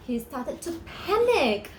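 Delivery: ordinary delivery, nothing marked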